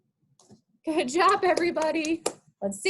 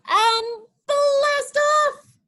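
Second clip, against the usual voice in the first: much higher, louder, very slow; blast off shout; 14 semitones higher, 7 dB louder, much slower than usual. Shown as a demonstration. Teacher shouts the blast-off to the kids. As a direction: launch it big and loud. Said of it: "We'll sing it together".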